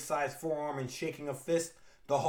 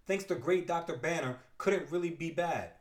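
usual speaking voice

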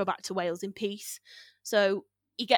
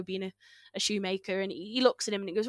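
ordinary talk

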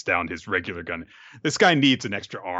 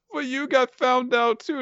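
much higher, timid little voice; squeaky meek voice. Retold or imitated. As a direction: shrink into a squeaky meek underling. Meek werewolf talks back.

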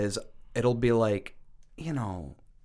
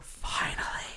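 breathy whispered finally; whispered relief; 4 dB quieter, slower than usual. Retold or imitated. Relieved to hear a Boston accent at last, whispered. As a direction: whisper it like a prayer answered.

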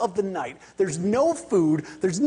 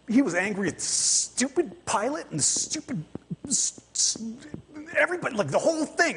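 higher, half-hearted grumbling of an angry passenger; mock angry rant; 7 semitones higher than usual. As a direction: lightly mimic the furious businessman griping at everyone.

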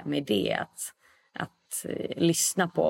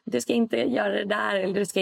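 mock shout, higher, the body's warning; body yelling no; 6 semitones higher than usual. Body screaming a warning at you, mock shout. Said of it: "när kroppen skriker bara".

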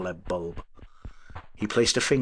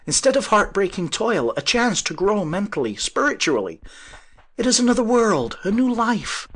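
ecstatic; 12 semitones higher, 6 dB louder than usual. Acted. Inventor's ecstatic vision.

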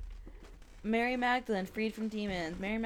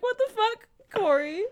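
higher, mock-indignant uncle; mock outraged uncle; 12 semitones higher, 3 dB louder than usual. Uncle reacting to hearing this podcast, mock outraged. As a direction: put on a high shocked voice. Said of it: "He's like".

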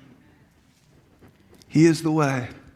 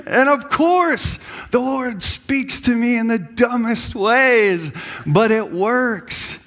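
ordinary delivery

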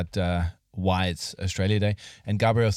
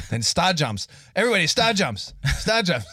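barking command, higher and faster; drill instructor; 7 semitones higher than usual. Retold or imitated. Mock camp instructor ordering the children, higher commands. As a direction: order the class like a drill sergeant. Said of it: "communist concentration camp".

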